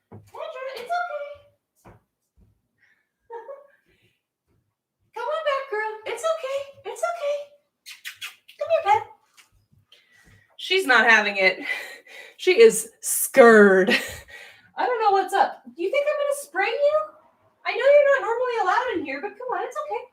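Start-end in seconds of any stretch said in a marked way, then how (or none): 0.1-9.0 high, soft sing-song reassurance; gentle high coaxing; high reassuring pet voice. Real, not acted. Coaxing scared cat back. 14.8-20.0 high soothing coax; high reassuring pet talk. Real, not acted. Reassuring scared cat.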